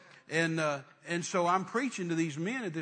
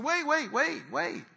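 urgent wait; 10 semitones higher, 3 dB louder, slower than usual. Retold or imitated. Stops the family mid-praise.

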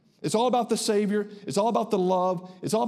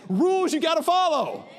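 preaching climax; 8 semitones higher than usual. Real, not acted. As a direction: drive it home to the congregation, rising.

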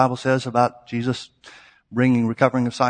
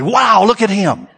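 mock amazement; 9 semitones higher, 10 dB louder, much slower than usual. People admiring the healer.

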